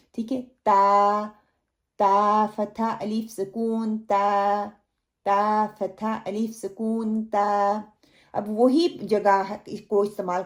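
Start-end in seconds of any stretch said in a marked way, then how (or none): 0.6-1.3 تا with light tongue tip, no lifting; thin ta. Shown as a demonstration. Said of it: "جو باریک تا ہے". 2.0-7.8 slow spelled تا فتحہ الف سکون تا; spelling out ta slowly. Shown as a demonstration. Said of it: "جو باریک تا ہے".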